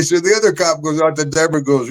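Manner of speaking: usual speaking voice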